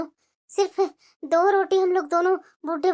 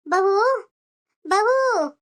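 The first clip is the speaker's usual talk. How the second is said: calling out; 3 semitones higher, 5 dB louder, much slower than usual. Acted. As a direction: call out loudly to the daughter-in-law across the house. Mother-in-law calling daughter-in-law. (बहू को ज़ोर से आवाज़ लगाते हुए)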